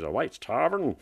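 a hint of a Belfast accent on the barman's answer. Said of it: "It's a very good Belfast accent".

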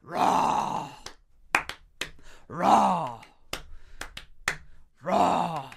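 dinosaur roar. Pretend. Host roars again on request. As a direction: growl it like a beast. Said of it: "do that again".

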